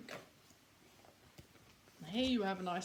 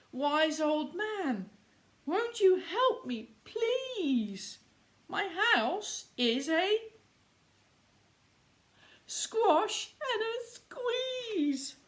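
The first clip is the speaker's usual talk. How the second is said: put-on high, begging old lady voice; pleading old lady; 9 semitones higher, 3 dB louder, slower than usual. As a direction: plead with him, high and desperate. Old lady begs wise man.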